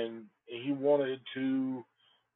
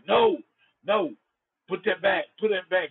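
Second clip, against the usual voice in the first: loud, higher-pitched urgent order; 4 semitones higher, 5 dB louder than usual. Retold or imitated. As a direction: blurt it as a retold alarmed command. Friend's urgent order, acted.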